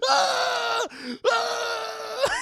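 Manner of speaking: high loud hammed-up scream; mock pained scream. Chucky getting his face sliced, high hammed-up scream. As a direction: scream as the blade hits.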